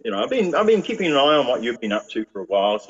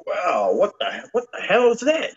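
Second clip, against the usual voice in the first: retold astonishment; 9 semitones higher than usual. Man reliving the moment lights appeared, stunned and higher. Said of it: "I'm like".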